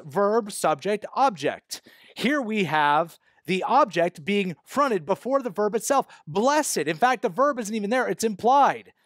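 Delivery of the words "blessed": "Blessed" set apart, pitched high; stress on "Blessed". Shown as a demonstration. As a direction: land emphatically on "Blessed". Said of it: "is emphasized".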